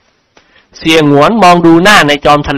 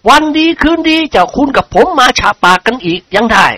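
lightly voiced friendly nobleman, pitched up; hospitable, raised-pitch character voice; genial lord character; 8 semitones higher than usual. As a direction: play a lord urging his friend along.

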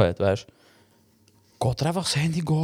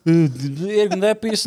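whiny daughter; 5 semitones higher than usual. Retold or imitated. Daughter whining to father.

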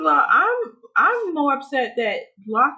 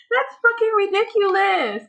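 ordinary talk, nothing marked

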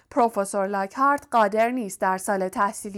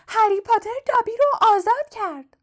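very high, shrill elf squeal; piercing house-elf; 12 semitones higher, 3 dB louder than usual. A narrator's reading. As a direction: repeat it even higher, ear-splitting elf. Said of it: "جن خانگی با صدای تیز و گوشخراشش گفت".

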